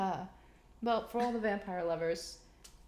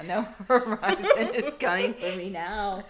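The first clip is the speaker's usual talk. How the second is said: laughing; 2 semitones higher, 10 dB louder, slower than usual. Real, not acted. Joking about Verizon texts.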